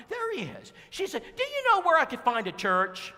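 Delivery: high, loud eager lady voice; eager woman blurting the question; Brenda's voice. Retold or imitated. Preacher voices Brenda asking.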